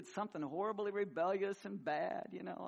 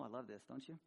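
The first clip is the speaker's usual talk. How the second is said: ordinary talk, nothing marked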